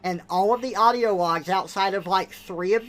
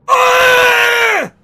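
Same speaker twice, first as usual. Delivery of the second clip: long, loud, high mock scream; mock agonised scream; 15 dB louder than usual. Person being experimented on by scientist, mock scream. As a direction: let out a hammed-up scream of pain.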